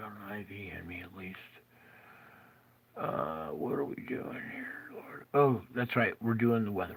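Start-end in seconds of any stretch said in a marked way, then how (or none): 0.1-5.2 soft, exhausted whisper to the Lord; sick man whispering a complaint to God; weary whisper. Real, not acted. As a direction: sigh the words out, barely voiced.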